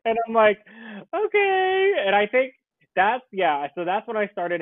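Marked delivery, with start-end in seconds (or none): 1.1-1.9 feeble whimpered okay. Retold or imitated. After doctor says he's fine.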